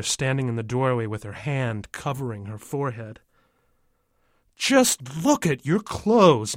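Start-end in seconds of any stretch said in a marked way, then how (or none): 4.6-6.6 slow, loud, raised scolding; angry yell. A narrator's reading. Father scolds the kid over dirty clothes, yelling. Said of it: "my father yells".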